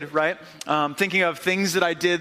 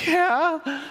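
put-on higher kid voice, timid yes; sheepish little boy; 10 semitones higher than usual. Eight-year-old admitting guilt to his dad, small and sheepish. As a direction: answer like a caught child.

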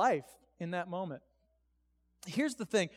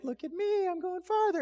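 high-pitched cartoon squeak, mocking a friend; Mickey Mouse; 12 semitones higher than usual. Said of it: "he speaks like Mickey Mouse".

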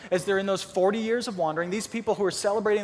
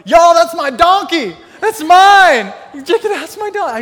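much higher, much louder proud shout; proud owner; 9 semitones higher, 14 dB louder than usual. Retold or imitated. Donkey owner shouting proudly as Jesus rides his donkey. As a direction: yell it like a thrilled owner showing off his animal. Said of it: "I bet the guy that owned it was like".

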